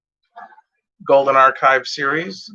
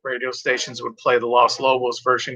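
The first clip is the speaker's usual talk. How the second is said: ordinary talk, nothing marked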